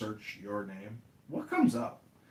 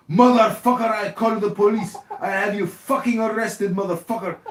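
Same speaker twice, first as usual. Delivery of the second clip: louder, higher furious threat; angry buyer; 6 semitones higher, 8 dB louder than usual. Retold or imitated. Outbid buyer threatening police, loud, higher furious shout. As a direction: blow up at the seller. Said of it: "And he goes".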